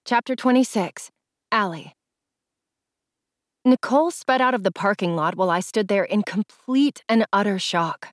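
ordinary delivery

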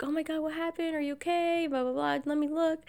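high, fussing pretend worry; mock concern. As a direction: fuss over her like you're worried. Fake concern during prank.

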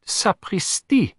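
slow, high outburst; a dramatic oath; exclamation. Acted. Poirot's exclamation of disgust.

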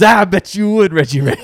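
loud, high taunt in a character voice; mock jeering shout. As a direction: jeer it as the movie jock. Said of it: "He goes".

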